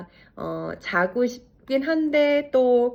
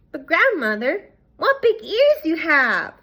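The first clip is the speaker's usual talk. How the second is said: put-on high, slow child's voice, louder; wide-eyed little girl; curious girl; 8 semitones higher, 5 dB louder, slower than usual. A narrator's reading. The girl marvels at her grandmother's ears in a high child's voice.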